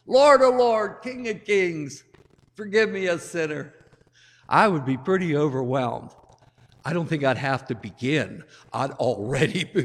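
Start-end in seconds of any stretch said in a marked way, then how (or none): 0.1-3.7 crying out higher and louder in mock awe; imagined plea. Priest imagining himself before the risen Lazarus, loud pleading. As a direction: throw yourself down begging forgiveness. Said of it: "I'd be down on my face".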